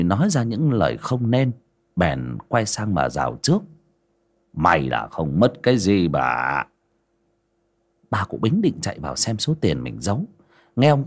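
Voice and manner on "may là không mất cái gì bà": low, slow, calming words; unhurried old husband's voice; reassuring old man. A narrator's reading. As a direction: gently settle a worried wife. Old man calms his wife.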